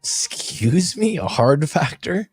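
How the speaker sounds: high, slow, drawn-out questioning; mock disbelief. Reacting to a viewer's question about a show, mock-shocked. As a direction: stretch it upward as if scandalized.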